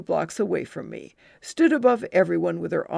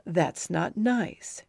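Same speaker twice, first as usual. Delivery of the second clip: slow mild rebuke; much slower than usual. Acted.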